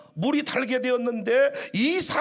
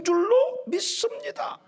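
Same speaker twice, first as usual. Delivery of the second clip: preaching shout; 8 semitones higher, slower than usual. Real, not acted. Preacher's emphatic declaration.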